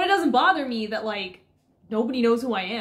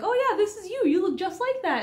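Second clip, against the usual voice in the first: much higher teasing voice, mocking Persephone; mocking Artemis; 10 semitones higher than usual. Artemis teasing Persephone about the mascot, high mocking voice. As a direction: tease her in a high voice. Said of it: "Or Artemis was like".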